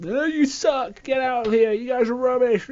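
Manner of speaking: high-pitched mocking troll voice; jeering troll. Troll heckling a small channel, jeering. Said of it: "there's been some trolls".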